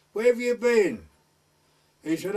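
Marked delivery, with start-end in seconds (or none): 0.1-1.1 higher, louder scolding shout; scolding call. Retold or imitated. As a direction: demand it from above. Woman scolding from window.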